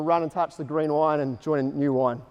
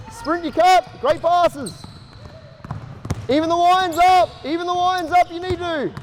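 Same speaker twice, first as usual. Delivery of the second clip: coaching shout; 11 semitones higher, slower than usual. Real, not acted. Coach to players mid-drill, shouting encouragement. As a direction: drive the players on from the sideline.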